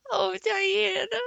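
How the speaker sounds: high slow cry with a sob; girl crying out to her friend. A narrator's reading. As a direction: cry out her name with feeling. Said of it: "cried Anne".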